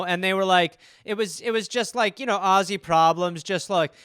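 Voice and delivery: ordinary delivery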